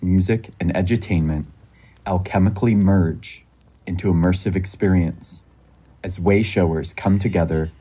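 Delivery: measured, projected announcer read; podcast intro. Acted. Host invites listeners around a sacred fire, scripted intro read.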